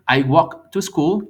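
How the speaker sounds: slow and loud, each word laid out; slow model sentence. Shown as a demonstration. Teacher contrasting tenses, slow careful model. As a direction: spell it out for the class slowly. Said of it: "No es lo mismo decir".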